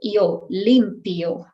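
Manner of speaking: limpio ending in i-o, slow; both vowels in limpio. Shown as a demonstration. Teacher models slow limpio for learners. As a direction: stretch the io of limpio. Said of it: "you have to pronounce both".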